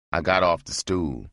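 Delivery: ordinary delivery, nothing marked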